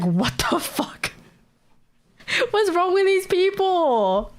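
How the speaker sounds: slow, laughing what the fuck; half-laughing shock at a stranger; stunned disbelief. Retold or imitated. Retelling her shock at the rude tram woman, slowed and laughing.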